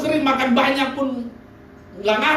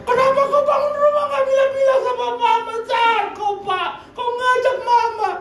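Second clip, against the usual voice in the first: mock wailing reproach; 11 semitones higher than usual. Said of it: "nangis begini".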